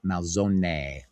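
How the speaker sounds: Malzone with the E said like ay at the end; Malzone, every letter sounded, ending mal-ZO-neh; careful Italian Malzone. Shown as a demonstration. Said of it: "the E is pronounced like an A".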